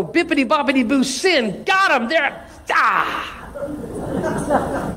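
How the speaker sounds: high, playful mock spell-casting; mock online attacker. Preacher mimics easy online jabs at others, mock gleeful. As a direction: act out a gleeful zap, ending in an exclamation.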